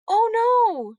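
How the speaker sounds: high, loud shocked cry; dismayed cry. A narrator's reading. Lucy seeing the smushed bracelet, shocked cry. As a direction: cry out through the hand over the mouth. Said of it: "she cried".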